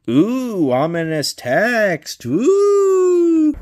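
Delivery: high, drawn-out ghostly ooh; mock spooky ooh. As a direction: play up the creepiness, hammed-up. Reacting to spooky description.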